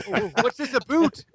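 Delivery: high, exaggerated Canadian accent; jokey Canadian accent, rising high; mock Canadian accent. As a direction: ham up a Canadian "aboot". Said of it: "then I get the, uh, boot accent".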